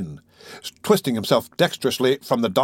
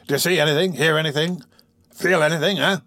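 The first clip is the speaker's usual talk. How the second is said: quick-fire questions, pitched up; bustling; 5 semitones higher than usual. A narrator's reading. Bustling doctor quizzes pale Oliver, all in a fluster. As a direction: fuss over him, question after question. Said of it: "as usual, all in a bustle".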